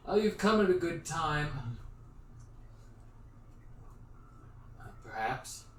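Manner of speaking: projected, formal guard's voice; guard addressing strangers. Acted. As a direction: call down to travelers from the gate. Guard greets travelers at the gate.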